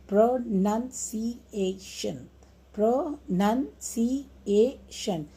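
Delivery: "pronunciation" slowly split, pro, nun, ci, a, tion; short pause after every syllable of "pronunciation"; "pronunciation" sounded out in five slow beats; divided into syllables. Shown as a demonstration. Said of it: "should be divided into syllables".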